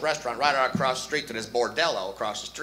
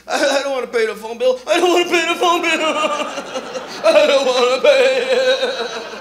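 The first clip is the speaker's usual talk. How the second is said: put-on much higher, whining child; whiny kid voice; 9 semitones higher, 8 dB louder than usual. Adult refusing phone bill, high kid whine. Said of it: "use that voice when you got older".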